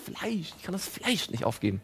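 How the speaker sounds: soft whiny mock plea; mock whimper. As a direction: whimper about giving up meat. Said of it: "fängt auch an zu weinen und sagt".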